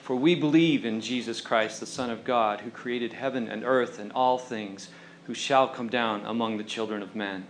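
many voices as one, low and loud; slow group recital in one voice; chorus in unison. Acted. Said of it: "they cried aloud with one voice".

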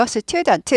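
student in four Korean syllables, seu-tyu-deon-teu; student with vowels added after s and t. Shown as a demonstration. Teacher mocking the learners' habit, four-syllable student. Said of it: "사음절 아닙니다".

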